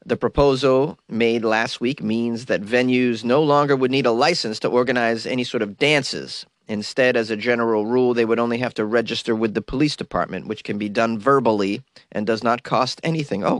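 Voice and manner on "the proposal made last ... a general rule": ordinary delivery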